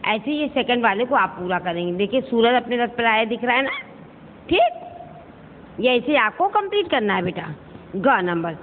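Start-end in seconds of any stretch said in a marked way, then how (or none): none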